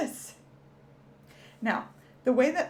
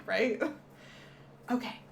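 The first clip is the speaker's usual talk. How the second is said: ordinary talk, nothing marked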